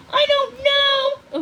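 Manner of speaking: high, loud, slow desperate cry; frightened. A narrator's reading. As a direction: near tears with fear for him. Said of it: "more and more frightened".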